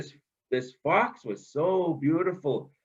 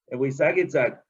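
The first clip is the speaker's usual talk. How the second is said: plain reading voice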